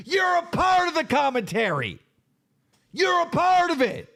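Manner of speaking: rising into a furious yell, part hammered; angry shout. Real, not acted. Streamer yelling at Rich that he is commentary, furious shout.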